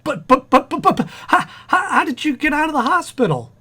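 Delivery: high-pitched, stuttering bewilderment; flabbergasted. Acted. Husband baffled how wife left hospital, flabbergasted. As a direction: sputter repeatedly before the question bursts out.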